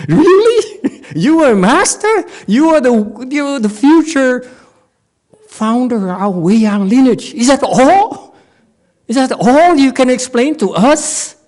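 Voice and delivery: high, incredulous mock taunt; mocking challenge. Student taunting the master's answer, high mock disbelief. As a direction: needle him, half laughing.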